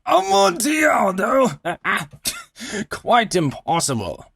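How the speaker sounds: incredulous high cry then a chuckle; scoffing Fortunato. Acted. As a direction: laugh off the claim as absurd.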